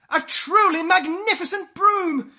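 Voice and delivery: high, loud, wondering announcement; triumphant storyteller's fanfare; grand reveal. A narrator's reading. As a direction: proclaim it as a marvel. Grand reveal of new broom.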